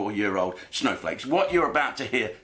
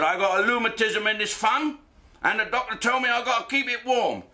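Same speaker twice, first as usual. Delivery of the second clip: put-on caricatured Chinese accent, pitched well up; mock Chinese accent; 9 semitones higher than usual. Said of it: "he said with a stereotypical Chinese accent".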